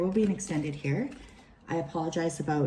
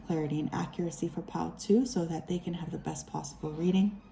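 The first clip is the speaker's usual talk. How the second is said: soft prayer; 4 dB quieter than usual. Acted. Invocation to spirit guides.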